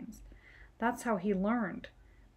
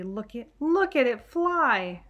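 put-on loud, slow, higher little-boy delight; thrilled child; 3 semitones higher, 7 dB louder than usual. Billy cheering his plane in flight, loud and thrilled. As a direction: point and marvel at the flying plane.

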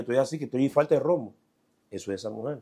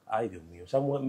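ordinary delivery

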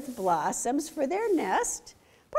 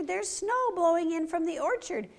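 urgent fox; 8 semitones higher than usual. Acted. Fox warns rabbits.

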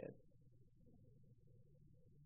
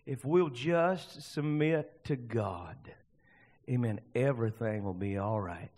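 ordinary talk

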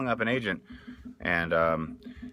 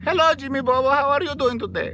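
higher, louder agent voice; smooth talent agent working his client; agent Salvatore; 9 semitones higher, 4 dB louder than usual. Pretend. Agent Salvatore calls Jimmy, high schmoozy voice. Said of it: "I'm the agent Salvatore".

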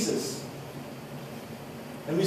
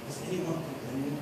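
soft, gentle question to the woman; Jesus gently asking the shamed woman, soft and kind; 5 semitones lower, 11 dB quieter than usual. Acted. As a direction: speak it softly with no judgment. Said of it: "the gentle spirit of Jesus says".

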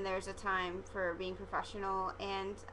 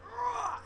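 high mock groan of disgust; a short exasperated high ugh; frustrated groan; 13 semitones higher than usual. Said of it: "I was just like".

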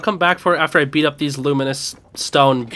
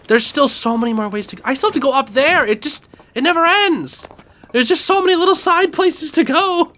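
exasperated; 8 semitones higher than usual. Real, not acted. Exasperated at endless area.

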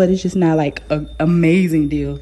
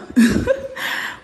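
high airy giggle; breathy laugh. Real, not acted. Laughs after near scam.

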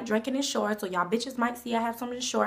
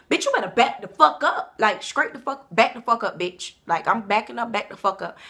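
retold confrontation; 3 semitones higher, 9 dB louder than usual. Confronting the BMW driver, loud and heated. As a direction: tell her off, stepping out of the car.

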